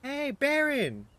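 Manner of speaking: high, loud, drawn-out call across the yard. Acted. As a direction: shout his name from a distance. NPC calls out from behind.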